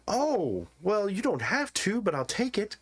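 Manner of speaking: high, fawning trader voice; greedy merchant. Acted. Merchant pockets the party's coins, high and fawning. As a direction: accept the coins with false modesty.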